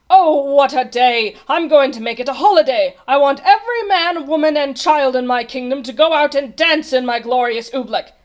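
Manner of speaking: loud, high, grand proclamation; jubilant king. A narrator's reading. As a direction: declare a holiday with royal delight. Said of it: "laughed the king".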